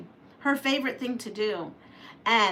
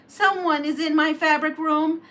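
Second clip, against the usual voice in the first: angry voice; 5 semitones higher than usual. A narrator's reading. Papa Bear finding the intruder, mild mock anger. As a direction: bluster like a cross papa bear. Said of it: "Papa Bear said in an angry voice".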